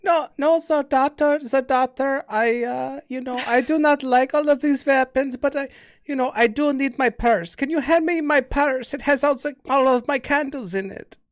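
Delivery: hammed-up old granny asking for her purse; old lady voice. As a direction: play the dotty old grandmother begging for her purse. Player voices old grandma character.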